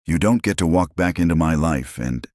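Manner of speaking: ordinary delivery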